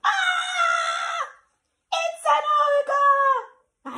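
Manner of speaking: very high frightened cry; mock terrified shriek. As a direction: scream like a startled little monster. Frightened monster sees ogre.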